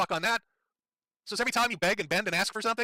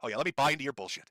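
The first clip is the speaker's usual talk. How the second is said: usual speaking voice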